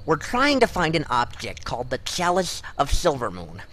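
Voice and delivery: normal talking voice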